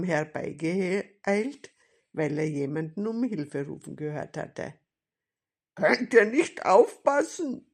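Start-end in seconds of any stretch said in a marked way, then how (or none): 5.8-7.7 loud, high-pitched telling-off; scolding. A narrator's reading. Policeman to boys who nearly ran into him, scolding. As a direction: scold them like an indignant policeman. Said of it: "schimpfte er".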